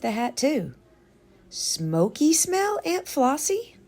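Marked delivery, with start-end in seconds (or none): 1.5-3.8 put-on higher, slow asking child; lightly voiced curious child. A narrator's reading. Child asks aunt.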